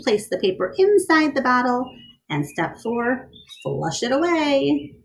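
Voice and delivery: lively storybook read-aloud; bright picture-book reading; reading to children. A narrator's reading. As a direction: read it to a class of kids.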